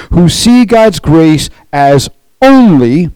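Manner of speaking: "only" drawn out slow and high; stress on only. Shown as a demonstration. Preacher stressing one word to his flock, only drawn out. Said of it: "I emphasize the word only".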